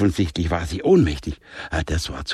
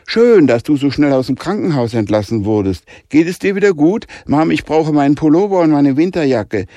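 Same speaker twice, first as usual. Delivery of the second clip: put-on higher and louder, eager girl; lightly voiced teenage girl; 6 semitones higher, 7 dB louder than usual. A narrator's reading. Daughter asking mom for warm clothes, eager girl voice. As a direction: rush it out eagerly.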